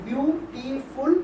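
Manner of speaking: "Beautiful" slowly as বিউ, টি, ফুল; short gaps between the three parts of "beautiful"; split into three syllables. Shown as a demonstration. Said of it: "তিনটে করে syllable হচ্ছে".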